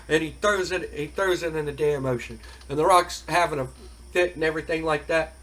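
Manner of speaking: ordinary talk, nothing marked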